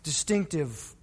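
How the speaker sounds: ordinary talk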